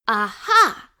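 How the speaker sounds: loud, sudden aha of discovery; eureka. Acted. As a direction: snap out the find like a lightbulb. Finds route on the globe.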